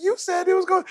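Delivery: high-pitched mock athlete voice; complaining athletes. Coach imitating athletes who brush off advice, high mock protest. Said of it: "they be like".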